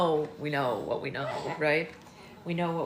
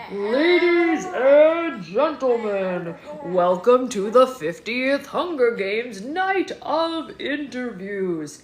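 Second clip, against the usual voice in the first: loud, slow showbiz announcer voice; grand stage announcement, stretched out; booming announcer; 3 semitones higher, 10 dB louder, slower than usual. A narrator's reading. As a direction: boom it out like a game-show host. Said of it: "a booming voice announces".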